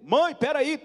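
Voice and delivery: high, loud plea; urgent calling out. Retold or imitated. Son stopping mother hanging up, urgent shout. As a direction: call out before the line cuts.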